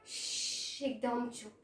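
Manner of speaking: long soft shush, then low, hushed words; barely disturb the silence, low and soft; quiet hush. Acted. Elder sister guarding the two sleepers, quiet hush.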